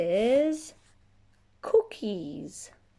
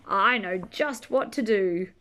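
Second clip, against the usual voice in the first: usual speaking voice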